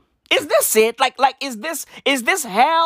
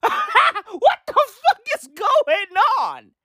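higher, very slow, laughing through it; laughing disbelief; 10 semitones higher, much slower than usual. Real, not acted. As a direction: laugh in shock at the news. Laughing at grim news.

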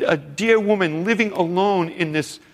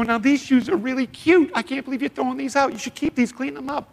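bright high coaxing voice; pitched well up, gently urging her to keep them; imagined Jesus coaxing; 10 semitones higher, faster than usual. Pretend. Jesus coaxing the woman to keep her shoes, high and gentle. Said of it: "He would sit there and go".

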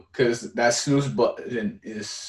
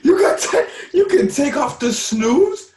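real shouted disbelief, much higher and louder; mind-blown outburst; astonished shout; 10 semitones higher, 7 dB louder, slower than usual. Real, not acted. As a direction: stretch it out in loud, high amazement. Stunned discovering snooze can be removed.

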